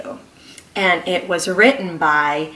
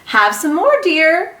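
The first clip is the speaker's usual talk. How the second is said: higher, sweetly coaxing offer; sweet mother voice; 9 semitones higher, 4 dB louder than usual. Acted. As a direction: coax like a doting mother. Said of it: "Missus Watson said in her sleep".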